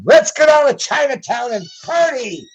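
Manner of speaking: high-pitched mock party cheer; Pelosi. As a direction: mimic her as a giddy party-goer. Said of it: "what was hers at the time?".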